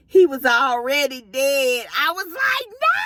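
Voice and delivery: retold stunned, tearful shriek; shocked wail. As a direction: voice climbing into a high cry. Retold news that patient was dead.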